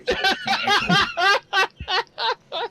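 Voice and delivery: laughing. Real, not acted. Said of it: "he talks loudly".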